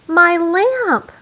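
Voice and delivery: high, loud cry of dismay; Mama gasps out the loss of her lamp; shocked cry. Acted. Mama finding her favorite lamp broken, shocked cry. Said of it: "said Mama".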